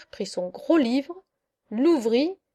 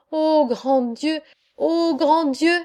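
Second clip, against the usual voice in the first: exclamation lente, plus haute à la reprise; consternation; 3 semitones higher, slower than usual. Acted. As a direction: se lamenter vers le ciel, en étirant les mots. Le curé consterné n'y trouve personne. (consterné, lente exclamation montante)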